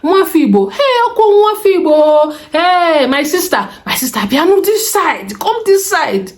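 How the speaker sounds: high-pitched imitation of hustling market hawkers; market touts calling. Market guys calling a passing shopper to their shop, mock hawker calls. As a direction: call out insistently, voice swooping up on each hail. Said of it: "calling you".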